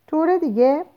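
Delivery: طور دیگه؟ asked high and rising; surprised question. A narrator's reading. Lenina stunned by Bernard's words, surprised rising echo. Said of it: "لنینا شگفت زده شد".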